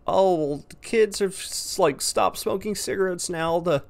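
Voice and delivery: mocking anti-smoking ad. Vlogger mocking a TV anti-smoking ad, in a high mock-cheery voice. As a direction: parody a smug public-service ad narrator. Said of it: "these commercials where it's like".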